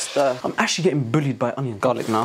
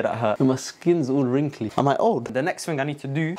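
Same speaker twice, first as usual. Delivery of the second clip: ordinary delivery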